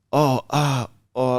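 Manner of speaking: lightly acted wail of distress; bursting into tears. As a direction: retell her pained outburst of tears. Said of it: "she bursts into tears".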